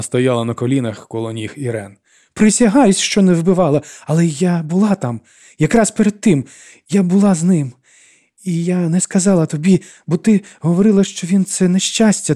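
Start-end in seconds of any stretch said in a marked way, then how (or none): 2.3-12.3 high, loud desperate confession; urgent, high-pitched self-defence; frantic pleading. A narrator's reading. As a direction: swear it in a panic, pleading. Frantic confession after crying out.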